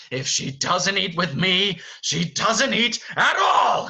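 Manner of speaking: high, loud, building to a slow roar; Beast, furious. Acted. Furious master forbids Belle food, building yell. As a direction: bellow the final words, drawn out. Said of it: "He is furious".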